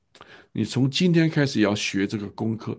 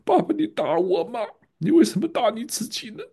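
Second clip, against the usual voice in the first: put-on much higher, a boy's wailing question; crying child; 12 semitones higher than usual. Son sobbing at his father, why do you hit yourself. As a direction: cry it out like a son who can't understand. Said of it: "他就嚎啕大哭".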